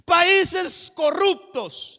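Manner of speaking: loud, high, drawn-out branding; slow shouted pronouncement; shouted accusation. Acted. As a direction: hurl the label out like a verdict. Voices negative labels on country.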